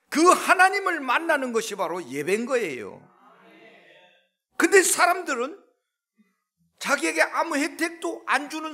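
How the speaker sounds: loud, high preaching climax; fiery preaching. Acted. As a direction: build the sermon to a shout. Preaching at full force.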